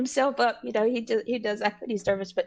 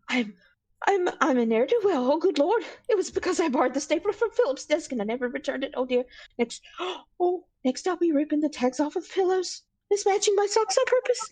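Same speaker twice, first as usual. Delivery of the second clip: hammed-up spiralling worry, climbing higher; flustered panicked self-talk; 5 semitones higher than usual. Bird character confessing petty crimes to himself, flustered panic. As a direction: gasp and fret over ever pettier crimes. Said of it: "he starts talking to himself".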